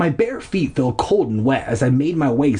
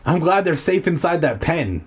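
ordinary delivery

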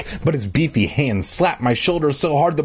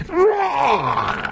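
strangled shout; 13 semitones higher than usual. Acted. Choking under strangling hands.

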